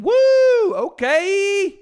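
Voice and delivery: high, loud whoop of delight; thrilled, shouted high; excited whoop. Real, not acted. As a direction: whoop it out, then a high drawn okay. Reacts to the odds, whooping.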